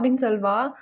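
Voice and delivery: ordinary delivery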